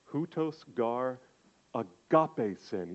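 slow, careful "Hutos gar agape sen"; Greek reading. Shown as a demonstration. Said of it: "here's how it sounds in Greek".

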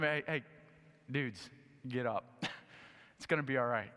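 Jesus soothing the terrified disciples, softly; gentle reassurance. Acted. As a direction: coax them up kindly.

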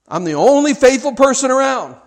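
loud, high, wailing self-pity; self-pitying lament. Acted. Preacher voicing Elijah's self-pity, loud and high. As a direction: whine it big like a martyr. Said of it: "that's how Elijah felt".

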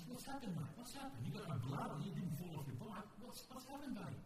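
a soft, worried father comforting his son; gentle worried dad. Retold or imitated. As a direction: coax the story out of a crying child. Dad gently asks crying son.

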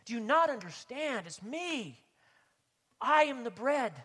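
a hint of Jesus pleading, loud and high.

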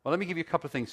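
ordinary talk

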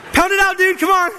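high, loud buddy hype; excited cheering. Acted. Guys in the boat knuckling each other, excited cheer.

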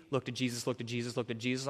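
low, soft repeated pat answer; mechanical, hushed repetition; rote advice. Acted. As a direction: recite the stock advice, low and quiet. Voices rote advice to struggler.

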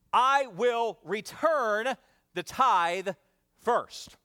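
slow, emphatic statement; slow declaration. Shown as a demonstration. Pastor giving the congregation the tithe line, slow. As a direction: spell it out slowly for the notes. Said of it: "we say it like this".

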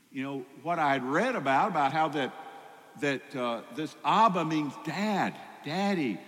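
normal talking voice

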